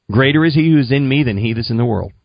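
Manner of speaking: quick run-through of the verse; brisk recitation. Retold or imitated. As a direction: rattle it off in one go, as copied down. Reading back the written verse.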